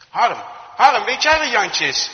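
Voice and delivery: loud, high questioning call; calling over. Acted. Farmer's wife asking Harm about the missing boy, calling over. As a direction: get someone's attention, then ask urgently. Calls another person while searching.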